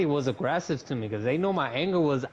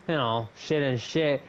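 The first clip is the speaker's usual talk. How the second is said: ordinary talk, nothing marked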